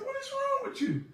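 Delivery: very high, exasperated disbelief; incredulous. Retold or imitated. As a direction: squeak it out in shock. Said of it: "I said".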